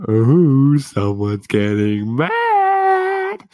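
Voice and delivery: loud, high, slow singsong taunt; mocking slow singsong tease; teasing taunt. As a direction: drag it out to needle her. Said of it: "And I'm like".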